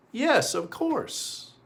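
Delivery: high, slow and soft, a kindly yes; gentle yes. Acted. Storyteller reassures the eager children, gentle and slow.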